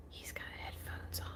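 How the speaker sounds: hushed, voiceless whisper. Real, not acted. Crafter after screaming at someone in headphones, whispering. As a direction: whisper it as a soft aside. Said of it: "Why are you whispering?".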